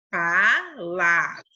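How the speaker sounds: Palaciano broken into slow syllables, pa-la-ci-a-no; slow syllable split. Shown as a demonstration. Teacher counts syllables for children, very slowly. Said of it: "Cinco sílabas".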